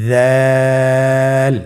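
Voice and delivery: দাল held long with the tongue tip at the teeth; long held Arabic letter; drawn-out letter. Shown as a demonstration. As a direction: stretch দাল slowly. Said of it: "বলার চেষ্টা করুন".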